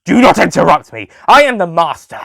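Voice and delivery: loud, high commanding rage; the Master asserting command over Cybermen, shouting; angry shout. A narrator's reading. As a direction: bellow your authority.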